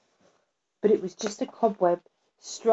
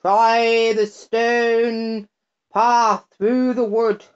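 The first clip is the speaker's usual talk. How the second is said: very slow, louder odd pebble voice; a pebble advises the lost girl, slow; 3 semitones higher, 4 dB louder, much slower than usual. A narrator's reading. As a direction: draw it out like a talking stone. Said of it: "said a pebble at her feet".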